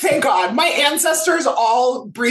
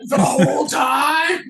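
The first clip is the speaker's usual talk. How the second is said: mock outraged disbelief; 9 semitones higher than usual. Acting out the end of Missus Doubtfire, mock wailing disbelief. Acting out film scene's shocked reaction.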